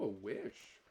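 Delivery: usual speaking voice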